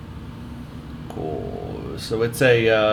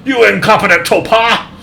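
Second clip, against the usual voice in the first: angry shout; 10 semitones higher, 8 dB louder than usual. Acted. Officer insults the warden.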